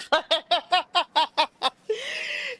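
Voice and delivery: high-pitched giggling burst; a high, real laugh over being fooled; high giddy laugh. Real, not acted. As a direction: laugh out high at how absurd it is.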